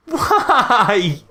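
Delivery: high, loud disbelieving cry; exasperated why. Real, not acted. Fan despairing that Mario skips the Goomba stomp, high and loud.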